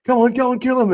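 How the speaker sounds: high-pitched imitation of a fan screaming; mock booming yell. Fan calling to favourite player from the stands, mock yell. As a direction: re-enact your cheer from the stands, pitched right up. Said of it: "scream out with my loud booming voice".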